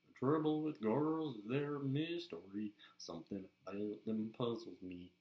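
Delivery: Southern country singer's drawl, sung; Southern accent. Shown as a demonstration. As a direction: croon it with a Southern twang. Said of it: "is a good Southern accent".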